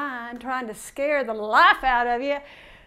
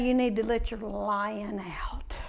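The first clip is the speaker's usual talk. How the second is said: breathy hushed aside; hushed whisper; 6 semitones lower, 8 dB quieter than usual. Real, not acted. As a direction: whisper it low and close. Preacher drops to hushed aside.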